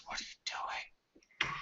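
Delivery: slow, hushed whisper as a captive elf; barely voiced character whisper. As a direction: whisper it slowly, wary of being overheard.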